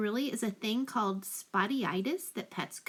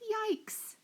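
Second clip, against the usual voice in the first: a quick frightened squeak; startled yelp; 11 semitones higher than usual. Shown as a demonstration. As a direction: reader asks the kids, squeaking the yelp. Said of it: "he would yell".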